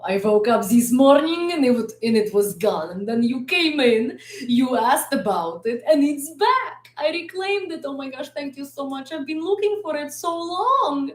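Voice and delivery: high-pitched, hammed-up relief; mock drama. Streamer mocking a question about the accent, sarcastic mock drama.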